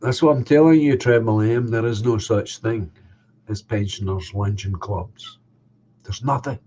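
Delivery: ordinary talk, nothing marked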